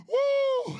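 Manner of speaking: high exultant woo; joyful whoop. Real, not acted. Preacher whoops to the congregation in joy. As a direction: let out a joyful whoop to the crowd.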